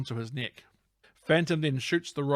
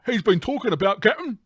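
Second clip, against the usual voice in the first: high, loud character voice; excited sidekick; 9 semitones higher, 11 dB louder than usual. Acted. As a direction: pipe up urgently to the captain. Acting Weeks' dialogue to the captain.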